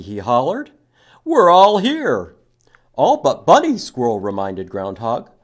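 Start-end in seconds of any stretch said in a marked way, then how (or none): 1.2-3.8 much louder, higher calling out. Acted. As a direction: shout it happily to friends. Story characters calling out.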